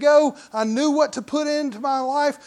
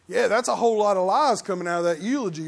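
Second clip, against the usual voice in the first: muttered skeptic; 3 semitones lower, faster than usual. Retold or imitated. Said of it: "most people go".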